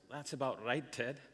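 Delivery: put-on quiet, understated Scottish accent; low-key mock Scottish reply. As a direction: answer softly in an imitated Scottish accent. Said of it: "in his Scottish accent".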